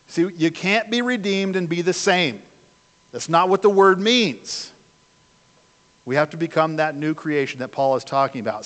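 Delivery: usual speaking voice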